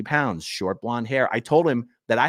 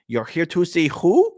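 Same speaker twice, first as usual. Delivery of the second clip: put-on slow, higher accented question rising on WHO; puzzled man with an accent, drawn out; imitated foreign accent; 5 semitones higher, 4 dB louder than usual. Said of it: "The man with the accent responded".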